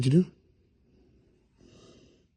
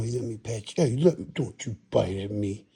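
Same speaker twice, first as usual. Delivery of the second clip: usual speaking voice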